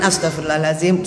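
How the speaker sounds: low, gentle murmured prayer; soothing istighfar. Acted. Friend saying istighfar for a crying widow while stroking her, soothing. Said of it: "kita yang istighfarin".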